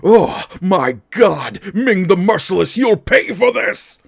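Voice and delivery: high, loud, melodramatic serial-hero cry; old serial hero. Retold or imitated. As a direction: ham it up like Flash Gordon. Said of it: "that's where the emotional levels are".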